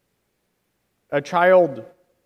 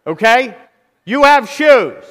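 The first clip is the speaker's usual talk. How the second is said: emphatic shout; 6 semitones higher, 9 dB louder than usual. Real, not acted. Preacher to a complaining congregation, shouting. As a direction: repeat it with a much higher, louder shout.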